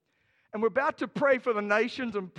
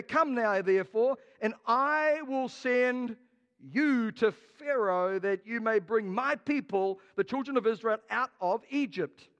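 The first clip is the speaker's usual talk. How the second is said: slow, louder solemn voice of God; solemn scripture; 4 dB louder, slower than usual. Acted. God sending Moses to Pharaoh, slow solemn proclamation. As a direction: read it out grandly and slowly. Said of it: "reading scripture as God".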